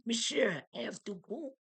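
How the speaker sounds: high-pitched mock French accent; French poodle's thoughts, high with a French accent. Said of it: "thinking with a French accent".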